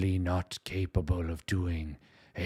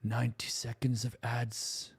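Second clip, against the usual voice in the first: whispered German accent; 2 semitones higher, 4 dB quieter than usual. Shown as a demonstration. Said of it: "It keeps just feeling like a whisper".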